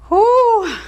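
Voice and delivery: high, breathy exhale of effort; high winded whew. Real, not acted. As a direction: blow it out, spent. Exhausted exhale after reps.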